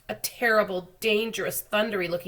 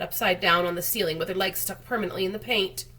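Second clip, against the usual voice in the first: ordinary delivery, nothing marked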